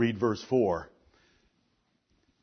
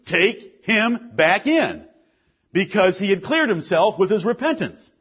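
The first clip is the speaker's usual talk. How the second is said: emphatic, projected sermon delivery; forceful preaching; 10 semitones higher, 10 dB louder than usual. Real, not acted. Pastor warning the church about sin, forceful preaching. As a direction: preach it firmly, landing key words.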